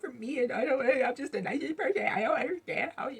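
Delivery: high, whiny fake sobbing; hammed-up pitiful whine; mock crybaby. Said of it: "sitting up here crying, like".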